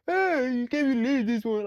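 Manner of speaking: mock an offended guy yelling at women; whiny angry man. Mocking short men ranting at women, high loud whine. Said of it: "And you guys are like".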